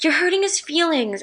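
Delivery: high-pitched mock reproach; reproachful friend. Friend telling her off about her crush, high reproach.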